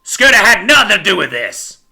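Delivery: loud indignant outburst; shouting. A narrator's reading. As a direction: shout it in defense of your boy. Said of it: "Horace shouted".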